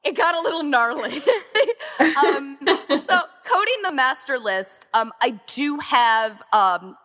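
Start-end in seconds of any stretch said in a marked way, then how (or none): 0.0-4.9 pitched up, laughing it off; giggly rueful admission. Real, not acted. Organizer admitting the job got messy, laughing. Recalling the gnarly master list.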